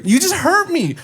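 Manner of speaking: high-pitched mock wail of complaint; hurt protest. Boyfriend to his swerving girlfriend, high-pitched mock hurt protest. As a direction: imitate a wounded, indignant boyfriend.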